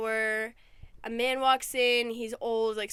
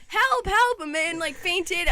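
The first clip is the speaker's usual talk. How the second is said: much higher, louder mock cry for help; screaming for help; 6 semitones higher, 5 dB louder, slower than usual. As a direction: retell the panicked yell, half-acted. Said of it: "everyone's screaming".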